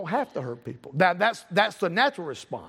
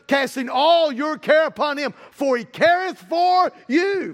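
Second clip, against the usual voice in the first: raised, ringing preacher's delivery; emphatic scripture quoting; 4 semitones higher, 4 dB louder, slower than usual. Acted. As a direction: build louder and higher, stretching the last words. Quotes scripture with force.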